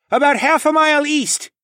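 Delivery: very high, loud shout over wind; yelling back. A narrator's reading. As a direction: yell it back across the storm. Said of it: "Tawa yelled back".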